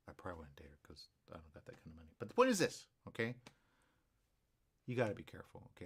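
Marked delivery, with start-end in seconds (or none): 0.1-2.1 whispered aside. Real, not acted. Confessional aside about money.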